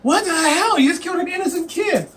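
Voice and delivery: high-pitched mock shock; hammed-up appalled protest; mock outrage. Players react to barbarian stabbing a kid, mock outrage. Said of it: "everyone's like".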